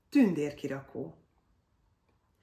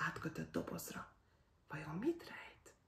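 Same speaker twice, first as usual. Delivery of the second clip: whisper; 15 dB quieter than usual. A narrator's reading. Narrator wonders what's in the box, whisper. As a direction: whisper the mystery to the listener.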